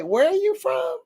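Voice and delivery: high-pitched question climbing at the end; imitated surprise. Quoting surprised people asking where they are from, high and astonished. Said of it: "people were so surprised".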